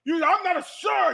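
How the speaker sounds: imitating an offended customer, high and loud; mock mad. Customer correcting the store clerk, mock mad. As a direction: pitch up in comic indignation. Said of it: "stormed out of there mad".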